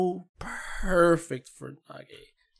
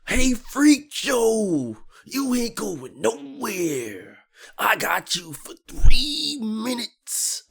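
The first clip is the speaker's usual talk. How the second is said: slow, drawn-out gloating taunt, a bit louder; hammed-up villain gloat, stretched slow; mock Potemkin taunt; 6 semitones higher, 5 dB louder, slower than usual. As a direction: taunt him like the big grappler has him trapped. Voicing Potemkin taunting opponent.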